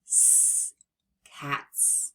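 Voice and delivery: s and cats said soft and voiceless; sounding out cats; unvoiced s. Shown as a demonstration. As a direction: breathe out the s, then cats. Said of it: "individual sounds".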